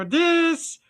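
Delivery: high squeaky character voice; a quick high puppet voice; high put-on voice. Shown as a demonstration. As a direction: pop up into a high cartoon voice. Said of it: "sometimes I talk like".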